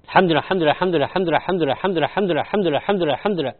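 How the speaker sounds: fast run of الحمد لله with the هاء and alif dropped, like "لْحمد لا"; clipped الحمد لله, swallowing the end of لله; rushed tahmid. Retold or imitated. Teacher imitating people rushing after prayer, clipped. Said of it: "لأن بعض الناس يقول".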